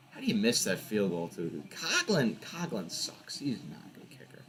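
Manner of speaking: a real quiet, scornful grumble about the kicker; muttered aside. Real, not acted. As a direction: grumble it to yourself as you trail off.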